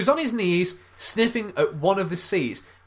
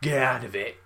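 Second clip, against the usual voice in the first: normal talking voice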